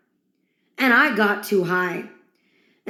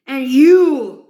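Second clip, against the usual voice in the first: loud burst; 7 dB louder than usual. Acted. Rick voice casting Morty.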